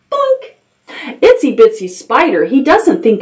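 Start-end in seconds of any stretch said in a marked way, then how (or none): 0.1-0.5 tiny high, soft boink; boink landing noise. A narrator's reading. Spider lands on the goat, high little boink. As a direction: pop it out like a small bounce.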